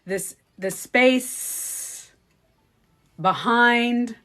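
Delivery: very slow, drawn-out meditation drone; exaggerated hypnotic guide voice; Joe Dispenza. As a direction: linger on each word with long pauses. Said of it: "And sometimes he goes".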